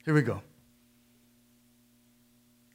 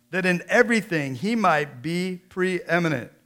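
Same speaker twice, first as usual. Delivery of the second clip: louder, higher ringing scripture proclamation; full-force preaching; 4 semitones higher, 7 dB louder than usual. Acted. Pastor reading Colossians to the church, proclaiming. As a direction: declare every line to the back row.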